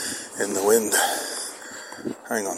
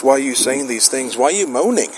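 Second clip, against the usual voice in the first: rising, scolding questions from an inner critic; nagging inner voice; 7 semitones higher than usual. Retold or imitated. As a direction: let the inner voice nag, climbing higher. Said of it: "you have the voice saying to you".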